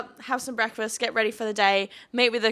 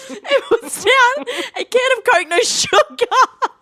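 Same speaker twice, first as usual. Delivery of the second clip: high and laughing, drawn out slowly; laughing squeal; 13 semitones higher, 7 dB louder, slower than usual. Real, not acted. Telling friends the Coke had no sugar, laughing high.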